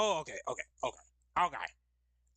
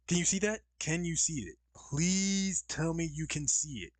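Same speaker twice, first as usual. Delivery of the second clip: higher, urgent plea, stretching "please"; hopeful pleading; 6 semitones higher, 3 dB louder than usual. Real, not acted. Streamer asking viewers if screen shows, pleading hopefully. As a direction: beg with rising hope, slowing at the end.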